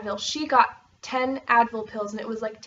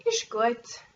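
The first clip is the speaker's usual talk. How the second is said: Ist gut said the local Swiss way; local Swiss German. Retold or imitated. Vlogger contrasting local dialect, Swiss German accent. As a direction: imitate how people here say it. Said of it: "here they say".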